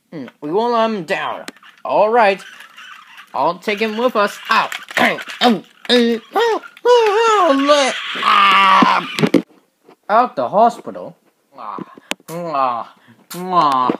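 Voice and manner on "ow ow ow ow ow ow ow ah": series of hammed-up ows rising to a loud ah; mock pain cries. Injured engine crying out ow repeatedly in pain. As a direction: cry out in pain again and again.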